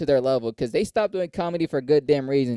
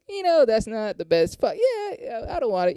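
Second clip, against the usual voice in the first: high-pitched mock hemming and hawing; awkward wavering; 8 semitones higher than usual. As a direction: imitate squirming under a friend's pressure, pitch up. Acting out wavering under friend's pressure.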